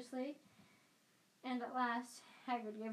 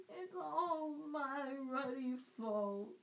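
slow, choked sob of guilt; slow sobbing giant blaming himself; 4 semitones higher, much slower than usual. A narrator's reading. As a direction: cry it out into your hands. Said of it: "he sobbed".

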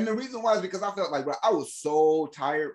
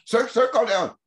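mock operator; 6 semitones higher, 4 dB louder than usual.

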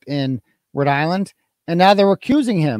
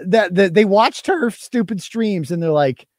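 ordinary delivery

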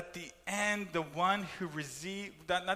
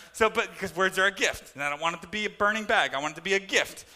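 preaching; 8 dB louder, faster than usual. Real, not acted. Preaching loudly again.

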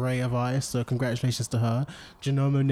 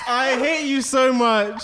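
slow, high, laughing loudly; mock-hate said through laughter; 11 semitones higher, 6 dB louder, slower than usual. Real, not acted. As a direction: tease a friend while laughing hard. Cracking up at joke.

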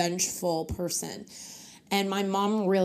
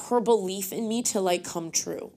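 ordinary talk

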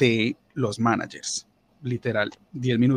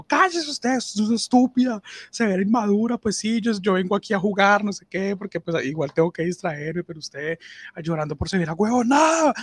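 much higher, heated shouting back; retold yelling; 8 semitones higher than usual. Shouting back at the crying coworker in the manager's office, heated. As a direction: yell her down in a petty fight. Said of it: "nos empezamos a gritar los dos".

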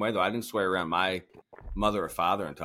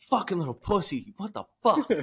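high-pitched mock-angry dad rant; retold angry scolding; 9 semitones higher than usual. Dad at his crying kid, mock-angry high scolding.

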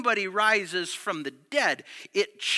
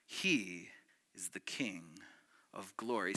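hushed declaration; 10 semitones lower, 12 dB quieter than usual. Real, not acted. Preacher closing the psalm in a hushed awe. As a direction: let it fall to a near hush.

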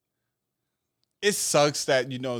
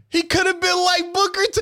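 much higher and louder; excited realization; 10 dB louder, faster than usual. Real, not acted. Cohost realizing a joke idea to his friend, excited. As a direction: it just clicked, blurt it out.

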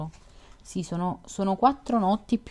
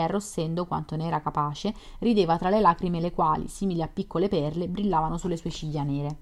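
ordinary delivery, nothing marked

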